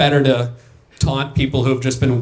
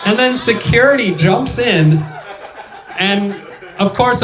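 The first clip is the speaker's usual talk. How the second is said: ordinary talk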